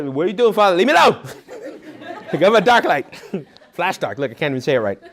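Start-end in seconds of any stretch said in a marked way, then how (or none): 0.0-1.2 put-on whiny kid. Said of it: "I'd, uh".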